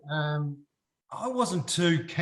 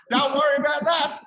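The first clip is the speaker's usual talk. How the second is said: much higher, loud flustered yell; panicked shout; 12 semitones higher, 9 dB louder than usual. Retold or imitated. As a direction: blurt it out in a panic. Retold panic at free drinks.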